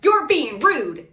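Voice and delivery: put-on loud, drawn-out Long Island accent quote; imitated Strong Island accent. Imitating Gina telling Tamara off, strong Long Island accent. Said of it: "she lost that Strong Island accent".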